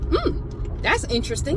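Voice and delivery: wry skeptical aside. Retold or imitated. Watching the two men leave, a high skeptical hmm. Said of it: "So I'm like".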